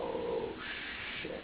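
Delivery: muttered oh shit, low and soft; under breath. Retold or imitated. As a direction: breathe it out in alarm. Retold panic, breathy under breath.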